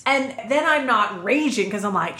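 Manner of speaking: normal talking voice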